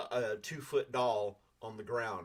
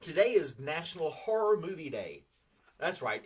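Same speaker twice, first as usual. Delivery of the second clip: normal talking voice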